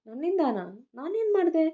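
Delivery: can't believe it's his fault; confused. Acted. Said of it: "ಗೊಂದಲದಲ್ಲೇ ಕೇಳಿದವನಿಗೆ". (ಗೊಂದಲದಿಂದ ಎತ್ತರದ ಧ್ವನಿಯಲ್ಲಿ ಕೇಳುವುದು)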